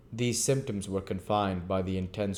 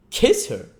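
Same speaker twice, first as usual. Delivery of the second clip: loud, high outraged exclamation; strong indignation; 9 semitones higher, 9 dB louder than usual. Acted. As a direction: explode with disgust. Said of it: "in strong indignation".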